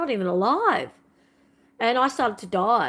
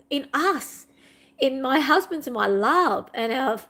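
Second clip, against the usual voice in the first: crying; 5 semitones higher than usual. Retold or imitated. Wife telling the neighbour her dream died, in tears. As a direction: let the voice crack as the tears come, slowing on the hope. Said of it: "I just started to cry".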